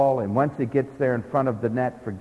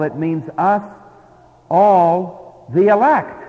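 "all" lifted and louder, then "the elect"; stress on all; 3 dB louder, slower than usual. Shown as a demonstration. Preacher to congregation, driving home "all" with loud stress. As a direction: lean hard on "all", then name "the elect". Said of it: "there's that word us".

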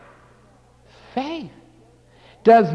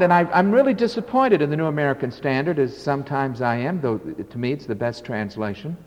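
usual speaking voice

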